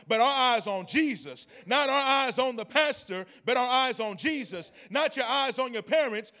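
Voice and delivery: building rhythmic preaching, rising. Acted. Pastor exhorting the congregation, rising preaching. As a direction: drive each line home to the church.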